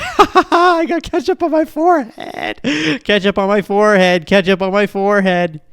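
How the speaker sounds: put-on high, loud giddy kid voice cackling; gleeful little kid shouting through laughter; laughing childish glee. Kid with ketchup on his forehead cackling with glee. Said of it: "I thought it was hilarious".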